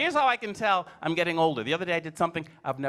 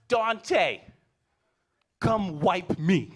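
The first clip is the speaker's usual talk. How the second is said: louder, very slow call; kid calling out; 2 semitones higher, 4 dB louder, much slower than usual. Retold or imitated. Boy calling his brother from the bathroom, slow and loud. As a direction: holler it slowly to the other room. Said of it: "He goes".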